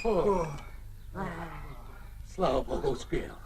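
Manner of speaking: very soft, low sigh of relief; relieved murmur. Acted. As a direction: murmur thanks under your breath. Relief after danger passed. (облегчённо, еле слышно)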